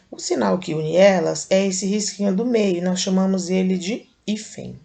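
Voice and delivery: ordinary delivery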